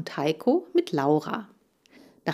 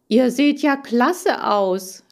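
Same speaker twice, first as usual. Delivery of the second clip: slow, higher, louder delighted cry; 6 semitones higher, 5 dB louder, slower than usual. Acted. Hamster sees his three mirror images, delighted. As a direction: marvel at the reflections. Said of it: "rief ich begeistert".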